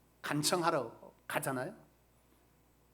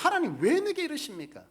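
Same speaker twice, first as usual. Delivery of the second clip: higher, louder cry of why me to God; loud pleading complaint; 10 semitones higher, 7 dB louder than usual. Retold or imitated. Preacher voicing believers' complaint to God, raised loud plea. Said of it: "우리는 말합니다".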